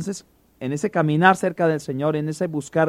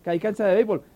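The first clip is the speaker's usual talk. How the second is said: high, eager mock costeño asking about the baseball field; coastal relative, excited; 6 semitones higher than usual. Preacher voicing the coastal relative eager to see baseball, excited. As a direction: play the excited visiting cousin, lightly. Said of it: "y dice".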